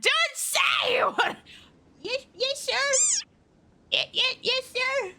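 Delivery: high squeaky yes sir; lightly squeaked obedient yes sir; squeaky avatar voice. Acted. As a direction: squeak it like an eager little servant. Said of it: "she's meant to be squeaking".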